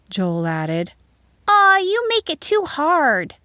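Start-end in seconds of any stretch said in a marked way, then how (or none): 1.5-3.4 put-on high, drawn-out child's grumble; louder high-pitched whine of protest; complaining. A narrator's reading. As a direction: complain slowly in a high schoolchild voice. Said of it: "Joanna complained".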